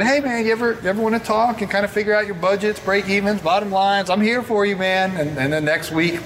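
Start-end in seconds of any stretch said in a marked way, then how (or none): 0.0-5.2 lightly voiced friendly coach, higher; a hint of an eager helper; friendly offer. Coach offering to go over budgets with Paul, lightly voiced. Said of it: "He said".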